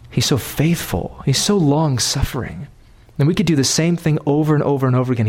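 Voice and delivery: ordinary talk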